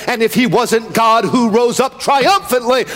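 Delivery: impassioned pulpit delivery building to a high climax; fervent, rising sermon cadence; full-force preaching. Real, not acted. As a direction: preach it up to a ringing, high finish.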